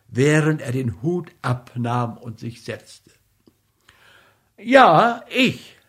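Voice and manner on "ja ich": put-on young man's voice. Mitja answers curtly.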